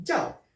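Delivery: "jiao" começando alto e caindo firme; faça o "jiao" cair de cima; quarto tom. Shown as a demonstration. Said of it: "Jiao é o quarto tom". ("jiao" no quarto tom, descendente)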